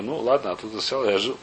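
normal talking voice

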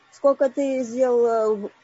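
ordinary talk, nothing marked

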